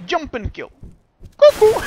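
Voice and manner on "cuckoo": shrieked cuckoo, very high; loud high cuckoo call. Real, not acted. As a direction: scream cuckoo out. Caster's catchphrase.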